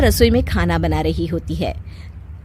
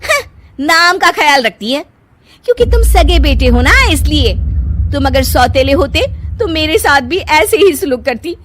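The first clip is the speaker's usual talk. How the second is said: high, loud scornful ultimatum; angry ultimatum; 8 semitones higher, 6 dB louder than usual. Acted. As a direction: issue the ultimatum bitterly. Wife ultimatum.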